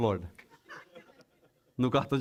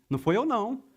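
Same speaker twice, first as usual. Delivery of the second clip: higher-pitched, mock-innocent protest; defensive denial; 5 semitones higher than usual. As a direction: deny it before anyone accuses you. Acted defensive denial to pastor.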